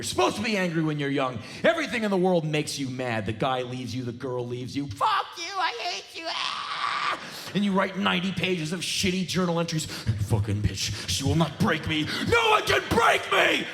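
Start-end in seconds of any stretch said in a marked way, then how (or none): 4.9-7.2 put-on much higher, raging teen yell; angry teen yell. Angry young person quoted. 9.8-13.7 put-on angry teen rant. Angry journal writer quoted.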